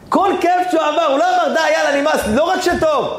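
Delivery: rally the crowd; soaring sermon fervour; fervent preaching. Acted. Preacher's fervent high passage.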